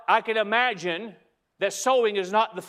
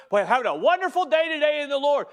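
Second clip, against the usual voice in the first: higher and fast, hammed-up chirpy brag; mock cheerful post; 5 semitones higher, 3 dB louder, faster than usual. Mocking a churchgoer's upbeat social media post, hammed-up cheer. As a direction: sell the fake happy day, brightly.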